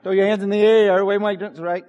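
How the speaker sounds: loud, playful concert hype; mock concert hype call; hype man shout. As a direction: pump up the crowd like a rapper. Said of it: "like a rapper up here".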